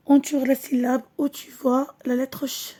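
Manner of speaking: ordinary talk, nothing marked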